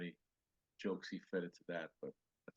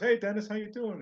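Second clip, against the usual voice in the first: much higher, louder friendly greeting; breezy hello backstage; 12 semitones higher, 8 dB louder than usual. Retold or imitated. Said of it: "he goes".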